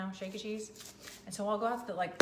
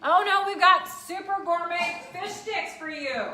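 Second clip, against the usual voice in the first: high, loud, slow mock-posh announcement; mock gourmet announcer; 8 semitones higher, 6 dB louder, slower than usual. Vlogger mock-presents dinner to viewers as a gourmet announcer. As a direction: announce the fish sticks like fine dining.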